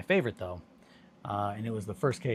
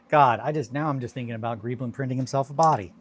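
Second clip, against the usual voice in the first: excited; 3 semitones higher, 11 dB louder than usual. Real, not acted. Excited about Greeblin body idea.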